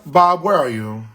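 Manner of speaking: ordinary delivery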